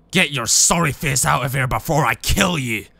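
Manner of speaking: rough, put-on voice of menace; threatening fridge. Fridge ordering the drunk out, rough threatening voice. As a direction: growl out the threat in character.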